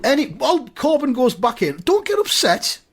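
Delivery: very high, pleading explanation; anxious, squeaked-up appeasement; flustered placating. Acted. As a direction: rush to soothe, voice shooting up. Placating an upset listener.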